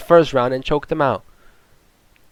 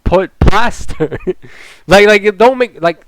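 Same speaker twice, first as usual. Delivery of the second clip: ordinary delivery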